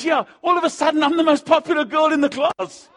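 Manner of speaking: put-on high, loud thrilled girl; a grin in the voice; delighted shout. Girl's delighted quote, shouted.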